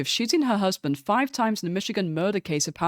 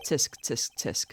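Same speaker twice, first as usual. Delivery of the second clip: mock tutting; 5 semitones lower, 5 dB quieter, slower than usual. Prosecutor tutting at lawyer.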